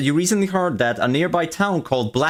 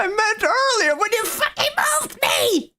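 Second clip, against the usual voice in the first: very high, exasperated yell; frustrated high-pitched outburst; angry shout. Real, not acted. Angry shout at the one who moved them.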